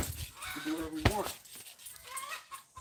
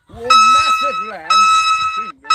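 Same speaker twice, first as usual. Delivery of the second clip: loud, high, slow and pompous, like a ruler bragging; mock grand boast; 19 dB louder, slower than usual. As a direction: proclaim the lands like a king showing off. Mock grand boast about family lands.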